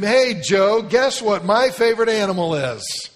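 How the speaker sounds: put-on high, loud eager child voice; chirpy little girl. Girl asking Joe to look out the window, high and eager. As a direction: invite him brightly like a chatty kid.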